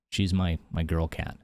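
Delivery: normal talking voice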